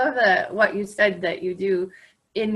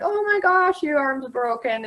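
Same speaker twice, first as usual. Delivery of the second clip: hammed-up gasp, much higher; mock alarm; 7 semitones higher than usual. Parent teasing a fallen kid, mock alarm. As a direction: fake panic to make a child laugh. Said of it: "they start laughing".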